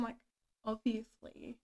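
ordinary talk, nothing marked

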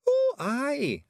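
very high, drawn-out cheery agreement; chirpy high pixie voice; cheerful. A narrator's reading. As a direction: agree brightly, pitched right up. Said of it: "said Rob cheerfully".

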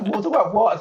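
high, loud incredulous question; mock bewilderment. Performer retorting to people demanding talk, loudly mock-baffled. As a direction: throw it back in loud, high-pitched disbelief.

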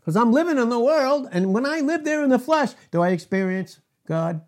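loud, driving preacher cadence; forceful preaching. Real, not acted. Preacher driving the point home to his congregation, loud and forceful.

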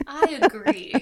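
loud I agree. Shown as a demonstration. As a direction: belt out "I agree" emphatically. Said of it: "I say".

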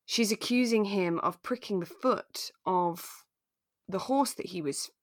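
normal talking voice

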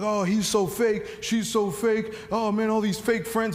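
high-pitched mock whining complaint; mock griping. Preacher mimicking people who complain about fake friends, mock griping.

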